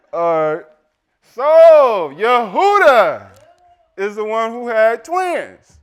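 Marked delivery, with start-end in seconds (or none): 1.2-5.8 loud, high, drawn-out announcement; big reveal. Real, not acted. Preacher revealing quiz answer to congregation, big loud announcement. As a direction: boom out the answer to the room.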